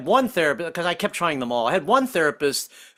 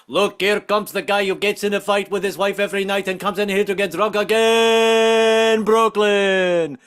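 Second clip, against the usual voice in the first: louder, slow, drawn-out announcement; Nico announcing; 4 semitones higher, 4 dB louder than usual. Retold or imitated. Greek restaurant owner introducing a regular, booming announcement. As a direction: draw out the intro like a ring announcer. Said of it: "it takes Nico".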